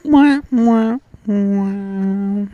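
high smacky mwah noises; squeaky kissing sound effect. Pretend.

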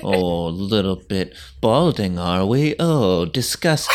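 slow, drawn-out sneer; mock someone's balding with disdain; cold teenage contempt. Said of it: "You know, like, she's so cold".

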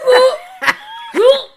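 high, very loud whoop of a gag; imitated queasy gagging. Listening to the clunks made her sick, imitated gagging. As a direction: mimic a stomach heave, loud and high. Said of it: "making me sick to my stomach".

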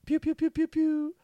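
high, loud laser-gun noises; pew pew sounds. Pretend. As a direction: fire off celebratory pew pews. Celebrating back to back awards.

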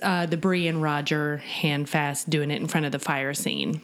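ordinary talk

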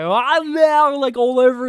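loud, high mock American accent, mouth all over the place; Benedict Cumberbatch American accent. Host mocks an actor's accent, loud over-mouthed American. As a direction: exaggerate a struggling Brit's American accent. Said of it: "Benedict Cumberbatch's mouth is, like, fully all over the place".

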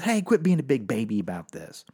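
normal talking voice

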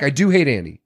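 ordinary delivery

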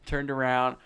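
ordinary talk, nothing marked

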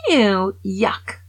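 a hint of a rude cartoon piglet; mock the pig's disgusted "Ew, yuck"; lightly voiced spoiled piglet; bratty Peppa. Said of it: "That's how this stupid pig talks".

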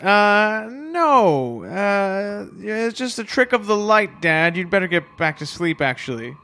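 put-on flustered boy. Boy covering up to dad.

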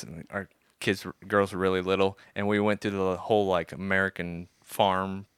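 ordinary delivery

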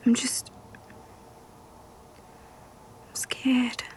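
frightened hushed confession; scared whisper. Acted. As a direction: admit fear in a whisper. Whispers throughout.

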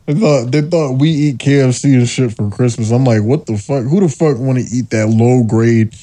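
ordinary delivery

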